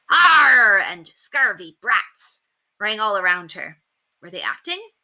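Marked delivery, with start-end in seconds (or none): 0.1-0.8 loud, high pirate roar; pirate actors chasing the children, roaring; pirate cry. A narrator's reading. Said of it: "Their cries of". 1.1-2.4 slow, drawn-out pirate jeer; a lightly voiced pirate snarl; pirate cry. A narrator's reading. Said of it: "Their cries of".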